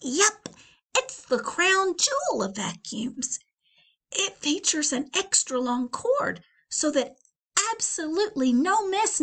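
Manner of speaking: high, bright salesman pitch; Donald's sales pitch. Acted. Husband pitching his new vacuum to his wife, high eager salesman voice.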